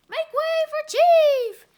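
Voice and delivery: bossy chief loudly announcing himself; booming entrance. Acted. Chief storms in.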